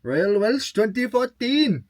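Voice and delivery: a rough, hammed-up Welsh accent, slow; terrible Welsh accent. Shown as a demonstration. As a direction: try a bad Welsh accent on it, drawn out. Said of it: "Terrible accent".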